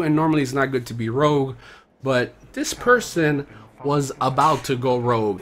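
low, measured voice-over; unhurried, low-key storyteller setup; video narration. Real, not acted. Voice-over introducing a gameplay clip.

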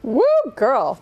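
loud, high whoop of delight; excited cheer. Real, not acted. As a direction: cheer her on at full voice. Cheering right after the speech.

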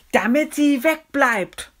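high, loud, drawn-out cry; slow, high-pitched exasperated shout; calling out. Acted. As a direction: call it out in exasperation. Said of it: "rief Julius".

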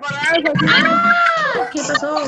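sudden high, loud yelp of surprise; startled cry. Real, not acted. Startled when someone suddenly joins the live session, loud cry. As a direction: jump at something unexpected.

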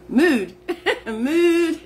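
"Mood, mood" with the oo drawn out, giggling; laughing moo again. Real, not acted. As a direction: moo it out while still laughing. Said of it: "I don't know why I accentuate that double O".

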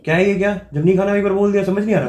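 faked angry shout. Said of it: "गुस्सा किस बात का हो रहे हो?".

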